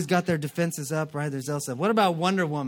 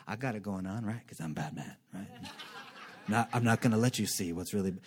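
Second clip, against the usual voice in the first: low, lightly growled bravado; mock Batman tough guy; 8 semitones lower, 6 dB quieter than usual. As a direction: put on the Batman front half-jokingly. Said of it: "masked person quoted as Batman".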